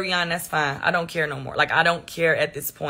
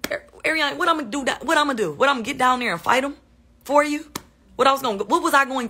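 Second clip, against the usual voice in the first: incredulous; 6 semitones higher, faster than usual. Real, not acted. Indignant rhetorical questions to accuser.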